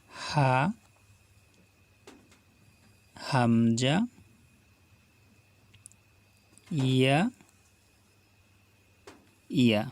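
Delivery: slow, drawn-out letter names for students to repeat; teacher reciting the alphabet; letter recitation. Shown as a demonstration. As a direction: chant each letter clearly, stretching it. Recites Arabic letters for repetition.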